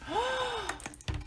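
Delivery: high, soft gasp of amazement; a sharp amazed gasp; stunned gasp. Real, not acted. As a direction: catch your breath at the gift. Seeing generous present.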